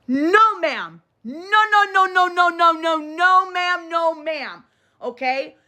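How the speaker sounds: high insistent string of no ma'am; repeated high-pitched no, no, no ma'am; emphatic scolding. Real, not acted.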